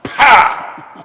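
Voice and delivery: loud, high sound-effect burst; explosive pow. Retold or imitated. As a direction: punch it like a bang. Imitating the bar shooting up.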